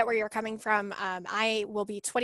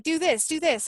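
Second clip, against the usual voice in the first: higher, louder mock commands; pushy nagging; 6 semitones higher, 5 dB louder than usual. Retelling the app's endless demands, mock-nagging. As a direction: nag like a bossy app, pitched up.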